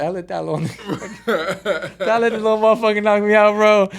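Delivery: high, loud, laughing through the words; cracking up mid-quote; laughing disbelief. Retold or imitated. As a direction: laugh at your own loss while saying it. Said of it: "the first thing I was like".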